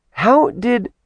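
high cut-off gasp of a question; a startled daughter cutting herself off. Acted. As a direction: blurt it out in shock.

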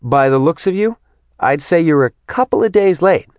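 high, scoffing woman's voice; acted mocking dismissal. A narrator's reading. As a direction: scoff it, climbing high at the end. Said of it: "She scoffed".

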